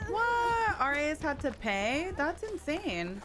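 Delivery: shocked disbelief. Real, not acted. Shocked that RAs paid.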